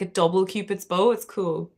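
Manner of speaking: ordinary delivery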